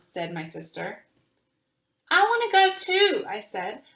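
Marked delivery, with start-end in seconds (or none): 2.1-3.2 put-on much higher, louder, eager child; eager plea. A narrator's reading. Little girl begging her family to join the protest, eager and high. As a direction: beg to be let in on it.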